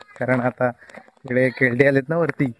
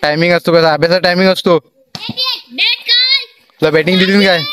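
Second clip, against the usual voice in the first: shouting across a distance; 7 semitones higher, 6 dB louder, faster than usual. Real, not acted. Calling across to kids playing cricket at dusk, loud shout.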